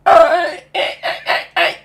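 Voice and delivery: high, loud creature yelps; sharp repeated squawks; excited creature chirps. Acted. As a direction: yelp like an eager pet creature. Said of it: "Pickles, like, clicks at you".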